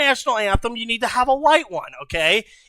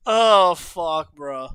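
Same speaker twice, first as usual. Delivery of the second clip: laughing; 8 semitones lower, 9 dB quieter than usual. Real, not acted. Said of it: "I'm losing it".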